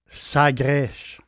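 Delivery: Sagres in a Portuguese accent, final s as sh: "Sagresh". Shown as a demonstration. Travel vlogger naming a southern town in his Portuguese accent. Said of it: "je prends mon accent portugais".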